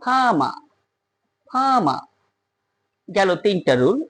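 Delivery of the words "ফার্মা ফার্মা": ফার্মা with no r sounds; non-rhotic farmer. Shown as a demonstration. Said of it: "উচ্চারণ হলো কি". (আর ছাড়া ফার্মা)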